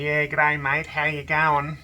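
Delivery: put-on Aussie accent. Joking about covid, a local greets a mate in a hammed-up Aussie accent. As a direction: play up a friendly Australian g'day. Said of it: "lose their Aussie accent".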